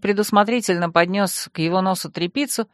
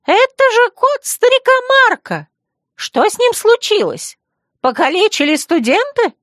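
high, loud squeaky shriek; squealing; 14 semitones higher, 5 dB louder than usual. Acted. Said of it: "Взвизгнул Писквиль".

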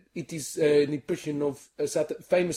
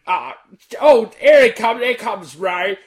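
Zippy; 6 semitones higher, 8 dB louder, slower than usual. Acted. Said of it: "am Zippy".

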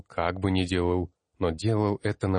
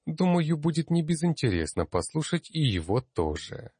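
high, projected address to the masters; raised-pitch rector's announcement; announcing; 7 semitones higher than usual. A narrator's reading. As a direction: formally appeal to a learned assembly. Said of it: "объявил".

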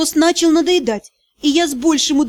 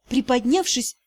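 plain reading voice

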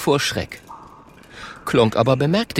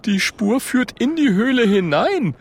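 high-pitched little character voice; alarmed Oskar; 12 semitones higher than usual. Acted. Oskar notices the trail leads inside, alarmed.

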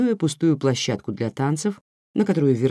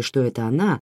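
plain reading voice

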